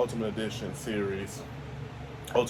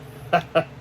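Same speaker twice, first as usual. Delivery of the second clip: short laugh; 7 semitones higher than usual. Real, not acted. Laugh after joke.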